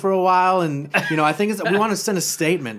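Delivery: high, loud, camp foreign henchman; chummy, hammed-up henchman voice; Tommy Wiseau-like accent. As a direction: explain eagerly in a lilting put-on accent. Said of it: "kinda sound like Tommy Wiseau".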